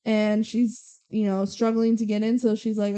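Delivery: ordinary delivery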